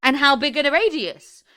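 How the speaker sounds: hammed-up disbelief at something nonsensical; mock bafflement. Mock baffled outburst at film line.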